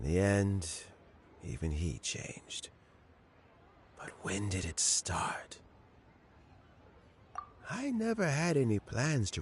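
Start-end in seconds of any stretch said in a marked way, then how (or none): 1.4-5.6 soft, hurt whisper; sorrowful whisper. Acted. Old man wondering when his friend turned, sad whisper. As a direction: barely voice the words, wounded.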